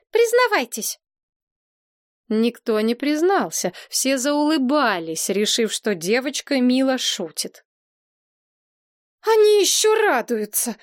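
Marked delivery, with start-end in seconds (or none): 0.1-1.0 high, sudden demand to confess; demanding order. A narrator's reading. Princess interrogates the suspects. 9.2-10.8 high, indignant outburst; furious princess. A narrator's reading. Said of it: "рассвирепела принцесса".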